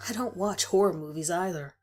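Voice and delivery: ordinary delivery, nothing marked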